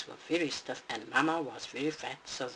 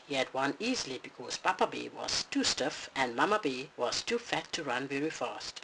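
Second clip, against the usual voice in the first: ordinary delivery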